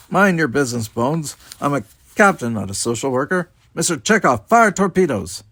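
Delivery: high, loud captain's rebuke building to an order; commanding retort. A narrator's reading. Captain rebuking doctor and ordering torpedoes, commanding. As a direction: put him in his place, then command.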